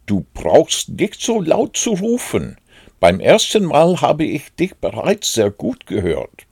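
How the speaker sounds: high-pitched complaint at the shouting; scolding reply. A narrator's reading. Hidden Rabbit tells Pooh not to shout, high scolding voice.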